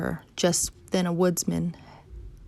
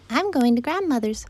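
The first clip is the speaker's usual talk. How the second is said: put-on high-pitched little girl voice; 7 semitones higher, 4 dB louder than usual. Girl tells the wolf her errand, high childlike voice.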